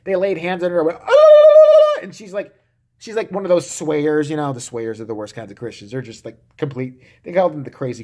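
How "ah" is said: high piercing shriek of a swayer; hammed-up holy-roller shriek; ecstatic shriek. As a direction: shriek like someone struck by the spirit. Church laying hands scene.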